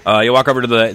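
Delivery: usual speaking voice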